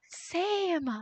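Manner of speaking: gasp, then a high, drawn-out squeal; thrilled to find a kindred spirit, pitch way up; excited agreement. Real, not acted. Streamer learns viewer is vegetarian too, excited.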